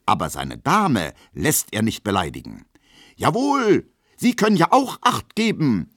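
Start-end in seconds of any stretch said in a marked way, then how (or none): 3.2-5.9 very high, loud indignation; indignant outcry. A narrator's reading. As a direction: cry out, outraged on a lady's behalf. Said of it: "ruft er in männlicher Entrüstung". (entrüstet gerufen)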